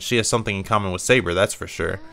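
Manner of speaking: ordinary talk, nothing marked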